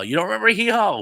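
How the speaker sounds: high, loud echo of disbelief; incredulous. Real, not acted. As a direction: throw it back as an astonished question. Reacts to that comment in disbelief.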